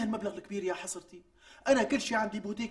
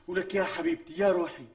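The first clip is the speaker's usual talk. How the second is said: usual speaking voice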